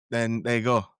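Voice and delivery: normal talking voice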